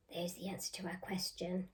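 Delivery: hushed aside; whisper. Acted. Teacher whispering the answer to the children.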